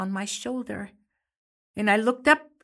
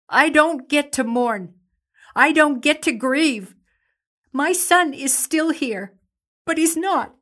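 raised high and loud in bitter anger; furious grief; 6 semitones higher, 7 dB louder than usual. Acted. Mother raging that she cannot grieve her son, high and loud. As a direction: let the anger burst out over the son who's gone. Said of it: "it makes me so angry".

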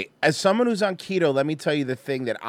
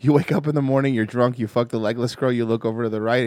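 ordinary talk, nothing marked

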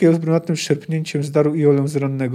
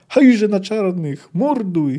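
drawn-out, higher war cry; battle yell; 5 semitones higher, 4 dB louder, much slower than usual. A narrator's reading. Thousands of soldiers charge outside the tent, yelling. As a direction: yell it out slowly across a battlefield. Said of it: "Tysiące głosów wrzasło".

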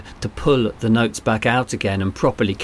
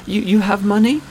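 put-on child voice; 11 semitones higher than usual. A narrator's reading. Boy voiced in story.

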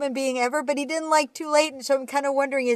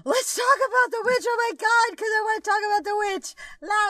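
gushing excitement; 9 semitones higher than usual. Retold or imitated. To Elijah Wood, a fan gushing about The Witch, high and fast. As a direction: burst out eagerly, voice jumping high.

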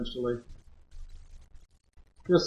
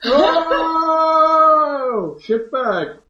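high, loud startled yelp; startled shout; 12 semitones higher, 4 dB louder, much slower than usual. Real, not acted. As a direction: jump and yell. Startled outburst at table.

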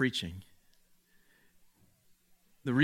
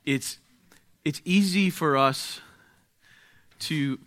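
prayer. Acted. Leads a prayer.

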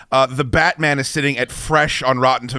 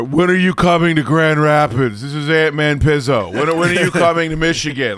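louder, put-on demanding fan voice; whiny fan commenter; 4 semitones higher, 5 dB louder than usual. Host mocking fans demanding tour dates, lightly voiced whiny fan. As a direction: nag like an entitled fan in the replies. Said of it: "the comments are".

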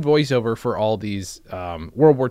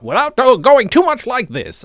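high, loud caricatured radio-guy voice; overdone radio voice; 11 semitones higher, 7 dB louder than usual. Shown as a demonstration. Showing what the actor avoided, exaggerated radio voice. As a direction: push the radio voice way over the top. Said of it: "Did my best radio voice".

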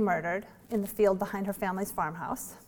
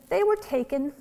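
lightly voiced child; 6 semitones higher than usual. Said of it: "she said".

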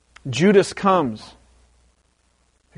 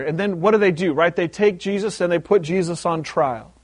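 usual speaking voice

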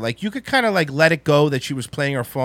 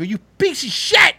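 higher, slow spit-out insult; retold parting insult; 9 semitones higher than usual. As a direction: fling it at him as you walk off. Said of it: "I was so mad".

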